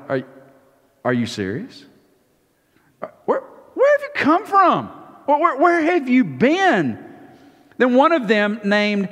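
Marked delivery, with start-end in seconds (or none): 0.1-7.0 high, incredulous cry of disbelief; voiced disciples, astonished and higher; stunned disbelief. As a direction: ask it like you can't believe your ears.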